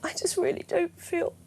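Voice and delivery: high, slow, whimpering sick voice. Pretend. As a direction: sound like you're about to cry. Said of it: "do your sick voice".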